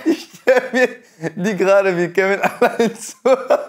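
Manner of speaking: high giggly voice, barely holding it; stifled giggles. Real, not acted. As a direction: laugh while you picture it. Pictures her as Kevin, laughing.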